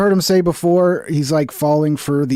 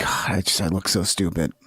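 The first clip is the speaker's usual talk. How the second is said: hushed, breathy self-criticism to the mirror; self-mocking mutter; 10 semitones lower, 7 dB quieter than usual. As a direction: whisper it at yourself in disgust. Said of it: "and I'm like".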